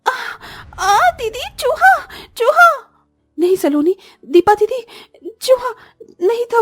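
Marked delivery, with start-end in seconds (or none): 0.1-2.7 frightened scream. Acted. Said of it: "दोनों डरकर जाग जाती हैं". 4.2-6.6 scared call. Acted. Calling sister about rat.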